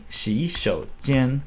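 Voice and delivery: "洗手间" said slowly, tone by tone; Mandarin tones. Shown as a demonstration. Teacher demos "洗手间" to learners, slow and clear. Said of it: "But if I say".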